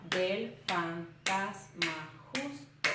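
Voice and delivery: "del fantasma justo" chopped into slow, separate syllables; a gap after each syllable of "del fantasma justo". Shown as a demonstration. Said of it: "se separa igual a como se dice".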